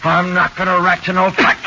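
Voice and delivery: loud, high stubborn refusal; defiant shout. Acted. Suspect refuses to talk.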